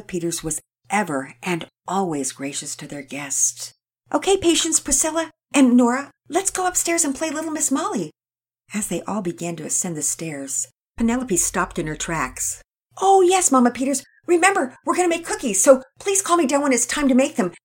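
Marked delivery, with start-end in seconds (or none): 4.1-8.1 put-on higher, louder girl calling her sisters; Penelope gathering the twins to play; Penelope, eager girl. A narrator's reading. 13.0-17.5 put-on much higher, louder excited girl; Penelope asking to be called for cookies; Penelope, eager girl. A narrator's reading. Penelope reminding mother.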